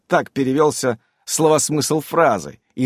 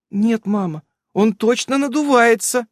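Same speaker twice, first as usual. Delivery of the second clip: anxious; 10 semitones higher than usual. A narrator's reading. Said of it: "с тревогой повторила".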